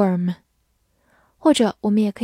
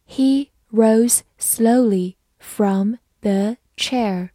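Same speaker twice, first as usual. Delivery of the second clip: "He rose slowly from the chair" word by word; slow reading; much slower than usual. Shown as a demonstration. Teacher reads the sentence for learners to follow, slow reading. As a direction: read very slowly, pausing after every word. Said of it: "好，慢慢来读".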